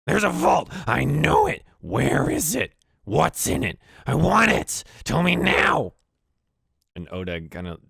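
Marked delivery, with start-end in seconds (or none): 0.1-5.9 high, loud goblin interrogation; frantic, piercing goblin shouting; yelling goblin. Acted. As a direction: scream demands at the prisoner. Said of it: "starts yelling at him".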